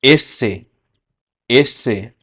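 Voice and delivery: एस से as Spanish "ese"; Spanish letter name. Shown as a demonstration. Teacher to students, careful Spanish letter name. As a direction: model एस से clearly. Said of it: "एस को कहते हैं".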